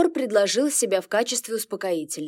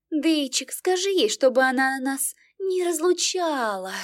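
put-on high drunk whimpering plea; whiny drunk pleading; 6 semitones higher than usual. A narrator's reading. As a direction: whine it like a tipsy girl. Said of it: "Ясва расхныкалась".

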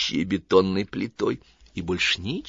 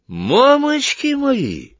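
frightened exclamation; 7 dB louder, much slower than usual. A narrator's reading.

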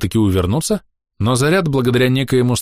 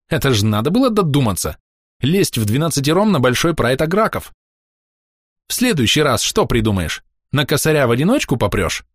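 mild scolding; 5 semitones higher than usual. A narrator's reading. Shleyla scolds Andrey.

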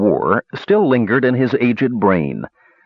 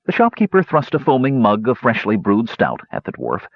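ordinary delivery, nothing marked

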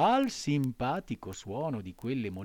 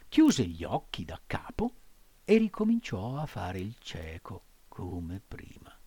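ordinary delivery

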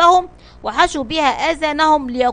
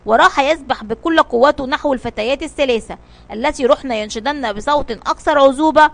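usual speaking voice

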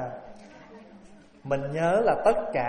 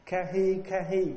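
normal talking voice

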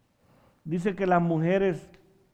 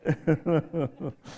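amused laugh trailing off; chuckle. Real, not acted. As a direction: keep chuckling at your joke. Laughs after local joke.

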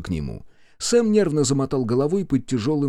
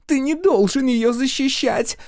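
very high, squealed protest; jealous shriek; 4 dB louder than usual. A narrator's reading. Chloe scolds her fiancé for defending the maid, jealous shriek. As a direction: shriek at him in outrage. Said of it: "взвизгнула Хлоя".

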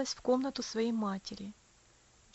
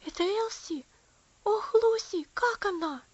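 horrified; 8 semitones higher, 5 dB louder than usual. A narrator's reading. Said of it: "в ужасе спросил".

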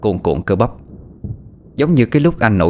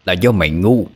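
taunting villain; 2 semitones lower than usual. Acted. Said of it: "giọng nói đã xuất hiện trước".

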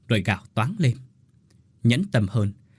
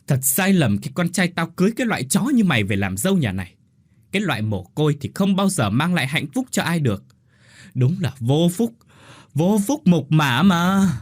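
roaring curse; 6 semitones higher, 4 dB louder than usual. A narrator's reading. Mother-in-law curses the orphan daughter-in-law, roaring rage. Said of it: "Mụ Lành gầm lên chửi rủa".

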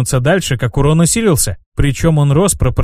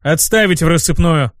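ordinary delivery, nothing marked

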